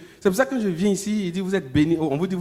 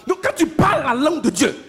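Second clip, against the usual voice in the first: preaching at full force; 9 semitones higher, 5 dB louder than usual. Acted. Preacher to church, the answer arrives fast: fervent preaching. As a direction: drive the point home to the congregation.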